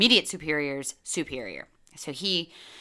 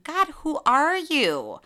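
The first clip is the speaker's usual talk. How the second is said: disbelieving self-question; 8 semitones higher, 6 dB louder, slower than usual. Retold or imitated. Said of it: "at myself thinking".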